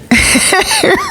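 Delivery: short high-pitched giggle; quick high chuckle; amused laugh. Real, not acted. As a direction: laugh at your own punchline. Laughs at own punchline.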